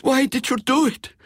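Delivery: high, loud, demanding countess; the countess crying out at Pierre across the table. A narrator's reading. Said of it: "she cried".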